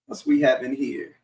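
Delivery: ordinary talk, nothing marked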